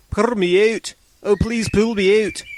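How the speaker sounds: high, thin plea for help; a small trapped voice calling out; small voice crying. A narrator's reading. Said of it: "he heard a small voice crying".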